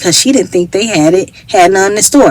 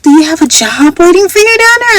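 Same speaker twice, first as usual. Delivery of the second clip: put-on curious older lady; 10 semitones higher than usual. Voicing the older lady asking.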